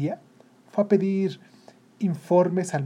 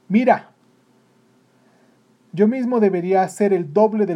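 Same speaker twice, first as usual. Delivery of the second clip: loud, raised interrupting rant; indignant father venting; exasperated father; 4 semitones higher, 8 dB louder than usual. Acted. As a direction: cut in and complain forcefully. Father cuts in, complaining.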